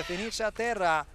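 normal talking voice

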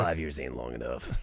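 low, soft menacing trailer line; dramatic movie voice. Pretend. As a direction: growl it like a film threat. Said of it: "that's dramatic".

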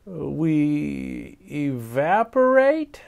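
slow and hesitant; unsure, dragging the words; uncertain. Acted. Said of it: "said Arnold uncertainly".

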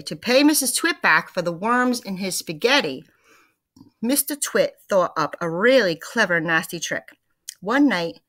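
lively read-aloud to the class; story narration. A narrator's reading. Teacher reading The Twits to her class, lively narration. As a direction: read it with energy for the kids.

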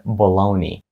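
bologna said as "buh-LOH-nee"; correct pronunciation. Shown as a demonstration. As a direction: end bologna in "-nee", with no g sound. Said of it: "правильною вимовою цього слова буде".

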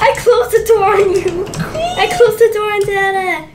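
giggling through the words; laughing loud and high. Real, not acted. Kid shuts door on dad, laughing.

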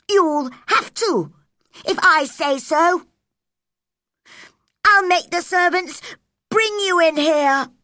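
loud, high, commanding threat; bossy spoilt boy. A narrator's reading. Spoilt boy Colin threatens Mary, loud and bossy. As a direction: order her about like a little prince.

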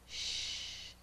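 long, soft hushing sound; soft shush. Acted. Mother quiets the story animals, soft shushing. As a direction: shush them gently down. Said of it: "They all were quiet".

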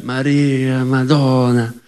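drawn-out, melodious Italian; beautiful Italian. Acted. Comic shows how lovely Italian sounds, in drawn-out Italian. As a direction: savour the Italian vowels, long and even. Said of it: "in Italian it'd be like".

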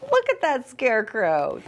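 ordinary delivery, nothing marked

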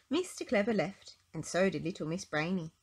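plain reading voice

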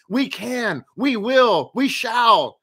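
pep-rally build-up, lightly done; rallying cry. Acted. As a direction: chant it like a rally, rising in force. Rallying positive slogan.

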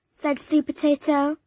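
usual speaking voice

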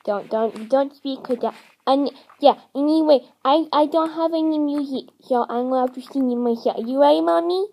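put-on childish introduction as a four-year-old hamster; little-kid hamster. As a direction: introduce yourself like a small child. Plays Timmy talking to Mommy.

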